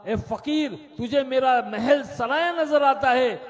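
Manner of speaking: the king bristles at the beggar; king rebukes the beggar, loud and high; indignant king. Acted. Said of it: "بادشاہ سلامت نے کہا".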